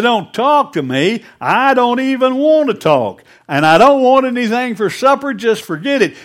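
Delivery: loud, high, furious outburst; seething anger. Acted. Angry farmer snapping at his wife after the mile, seething shout. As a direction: yell it like a man fed up. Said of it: "He's seething".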